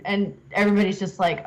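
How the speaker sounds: ordinary delivery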